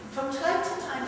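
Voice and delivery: ordinary talk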